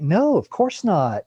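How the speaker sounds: slow, swooping flippant brush-off. Retold or imitated. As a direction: toss it off with exaggerated, drawn-out disdain. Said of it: "that kind of flippant attitude".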